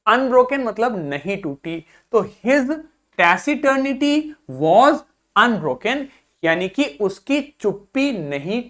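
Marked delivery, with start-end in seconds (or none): 2.4-6.1 slow dictation reading. Shown as a demonstration. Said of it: "teacher repeats example sentence".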